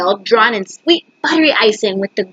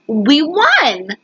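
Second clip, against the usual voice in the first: usual speaking voice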